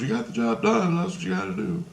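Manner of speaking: high, proud imitation of Christ. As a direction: voice him high and approving. Christ voiced in dream story.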